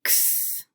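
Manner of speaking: X as a voiceless "ks", ending in a held hiss; isolated final sound. Shown as a demonstration. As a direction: say just the ks sound of X, not the letter name. Final-sound drill, isolated phoneme.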